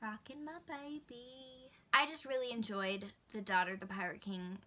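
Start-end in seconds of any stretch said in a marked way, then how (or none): 0.0-1.5 soft, slow cooing to the dog; tender aside. Real, not acted. Cooing to her dog while rocking it, tender. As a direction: rock her gently as you say it.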